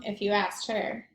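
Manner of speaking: plain reading voice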